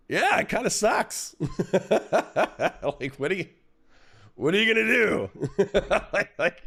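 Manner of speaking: high laughing through the words. Real, not acted. Streamer laughs off divorce question, voice up high.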